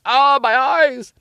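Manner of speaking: high, slow mock wail; pained wail. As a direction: wail it like it stings. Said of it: "and you're like".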